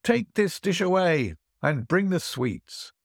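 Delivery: host ordering servants across the hall; mild call to the servants. Acted. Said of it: "The host cried out".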